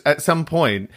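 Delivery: usual speaking voice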